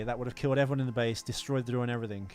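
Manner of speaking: usual speaking voice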